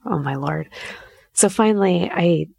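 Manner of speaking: usual speaking voice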